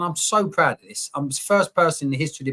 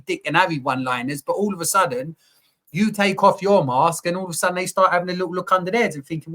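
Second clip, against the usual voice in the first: normal talking voice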